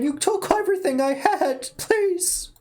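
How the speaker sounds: high, scared plea; frightened pleading. Acted. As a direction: beg for mercy, terrified.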